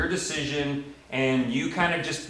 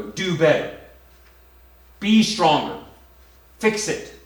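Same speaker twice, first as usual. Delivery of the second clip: a hint of a preachy scold, louder toward the end; mock scolding advice; 2 semitones higher, 4 dB louder than usual. Preacher mimicking a critic lecturing a struggling person, mock scolding. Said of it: "walk up and go".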